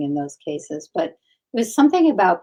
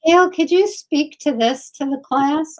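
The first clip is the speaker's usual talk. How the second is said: mock teacher; 9 semitones higher, 6 dB louder than usual.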